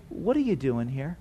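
soft, low, caring question; hushed, kindly prompting; gentle questioning. Acted. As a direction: ask it quietly and tenderly. God gently questioning the believer.

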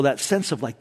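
ordinary talk, nothing marked

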